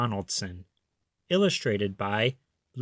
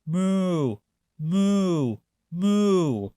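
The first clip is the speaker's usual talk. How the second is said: higher, odd-sounding moos; duck mooing; 5 semitones higher, much slower than usual. A narrator's reading. Duck fools the thieves, mooing. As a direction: moo three times like a cow. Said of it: "the duck on the pond said".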